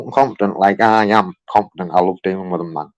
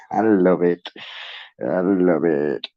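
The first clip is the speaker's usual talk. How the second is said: slow savouring; 4 semitones lower, 4 dB quieter, slower than usual. Real, not acted.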